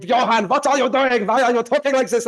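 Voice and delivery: high, loud imitation of a Swiss father; strong Swiss accent. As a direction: scold in a thick Swiss accent. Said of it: "He's got a very strong".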